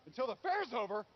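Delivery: outraged echo on the verge of yelling; rising outrage. Acted. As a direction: repeat it as your temper rises. Second repeat, temper rising.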